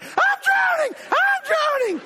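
high, loud, shrieking drowning cry; comic retold panic after being pushed under; panicked scream. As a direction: scream like you've just come up for air.

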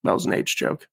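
ordinary talk, nothing marked

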